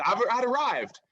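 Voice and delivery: big proud shout of arrival; exultant. Retold or imitated. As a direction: crow it like you finally made it. Exultant about finally making it.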